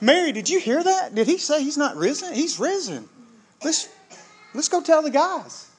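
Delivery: high, breathless excitement at the tomb; excited Mary. Acted. Mary urging the women to tell the disciples, high and excited. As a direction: gasp out the news, high and eager.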